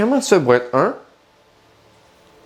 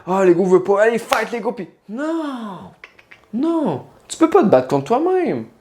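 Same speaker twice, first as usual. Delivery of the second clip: mocking voice; 8 semitones higher than usual. Mocking ego-fighters' advice.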